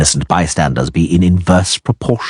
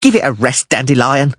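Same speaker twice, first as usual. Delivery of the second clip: higher, impatient snap; 8 semitones higher than usual. A narrator's reading. Dwarf cutting off the whining poet with a snap. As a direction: cut him off sharply. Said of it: "Denty snapped".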